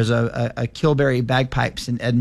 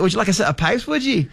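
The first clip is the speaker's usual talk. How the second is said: higher, lightly put-on Scottish shop owner; a playful hint of a Scottish accent; mock Scottish accent; 8 semitones higher than usual. Kilt maker offers bagpipes, in a light mock Scottish accent. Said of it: "he's Scottish".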